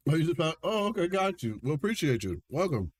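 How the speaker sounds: talking through a mouthful of cereal; words round a bite of cereal; eating cereal. Real, not acted. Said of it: "you caught me, uh, eating cereal".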